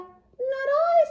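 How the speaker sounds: squeaky, very high mouse voice; tiny mouse voice. Acted. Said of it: "said the mouse".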